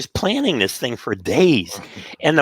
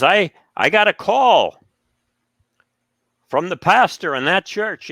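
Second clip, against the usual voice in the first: quoting the complaint with mock alarm; stern professor; 8 semitones higher than usual. Professor questions the club, raised mock stern voice.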